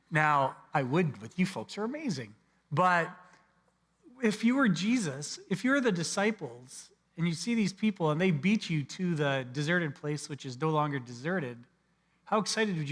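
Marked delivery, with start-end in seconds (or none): none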